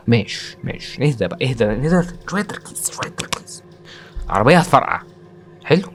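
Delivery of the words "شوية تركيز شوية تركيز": hushed whisper to himself; whispered self-coaching. Real, not acted. Gamer telling himself to concentrate, whispering. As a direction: whisper it softly to steady yourself.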